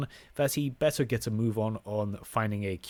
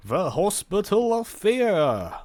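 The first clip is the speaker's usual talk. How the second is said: dramatic announcer; 9 semitones higher, 7 dB louder, slower than usual. Pretend. Announcing game's ominous name.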